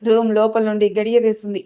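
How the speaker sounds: ordinary delivery, nothing marked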